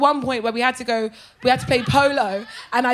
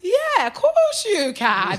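high, long-drawn reluctant yes; forced agreement; 5 semitones higher, much slower than usual. Retold or imitated. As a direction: imitate a bride stretching out her consent. Bride's mortified forced consent, acted.

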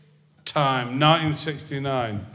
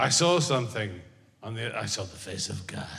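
hushed, softer, awed voice; awed astronaut; 5 semitones lower, 9 dB quieter than usual. Retold or imitated. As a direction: say it low and in awe. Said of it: "That's what he said".

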